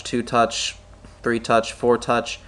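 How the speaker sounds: ordinary talk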